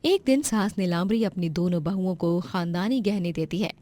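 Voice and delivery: ordinary delivery, nothing marked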